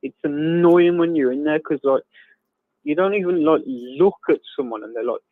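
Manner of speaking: normal talking voice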